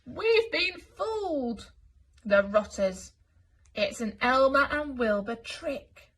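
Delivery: high, slow, loud and strange; strange muffled voices. Acted. Elephants holding tails, speaking strangely at once. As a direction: talk with trunks full of tails. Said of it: "their voices sounding very strange".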